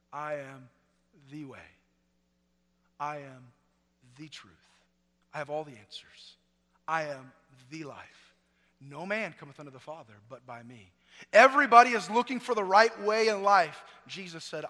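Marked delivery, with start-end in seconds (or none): 0.1-6.4 hushed recitation. Acted. 6.9-8.3 soft and slow, words spaced; whisper-close, reverent; hushed quote. Acted. 8.8-10.9 hushed recitation. Acted.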